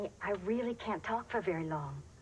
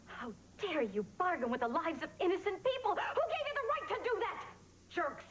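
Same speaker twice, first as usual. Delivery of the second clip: outraged tirade; 9 semitones higher than usual. Acted. Freed hostage berating the captors, outraged high rant. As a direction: pitch climbing in indignation.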